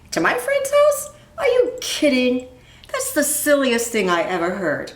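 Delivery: high, mocking "are you kidding"; incredulous boy brushing off his sister; scoffing disbelief. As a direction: scoff at the silly idea.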